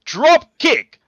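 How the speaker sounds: loud, high, slow royal proclamation; roaring tyrant king decree; King of England voice. Acted. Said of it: "in your King of England voice".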